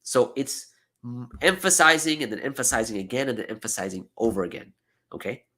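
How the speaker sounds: ordinary talk, nothing marked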